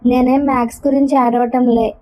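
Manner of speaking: ordinary delivery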